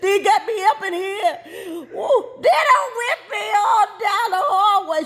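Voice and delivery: put-on high, wailing child through laughter; mock crying. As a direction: sob it out like a whipped kid. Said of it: "I'm crying louder".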